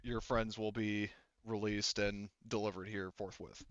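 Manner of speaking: ordinary talk, nothing marked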